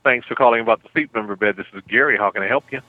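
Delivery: ordinary talk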